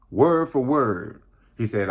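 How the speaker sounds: normal talking voice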